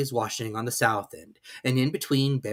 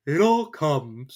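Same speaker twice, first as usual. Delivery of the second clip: cross Pooh; 4 semitones higher, 5 dB louder, much slower than usual. A narrator's reading. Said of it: "said Pooh crossly".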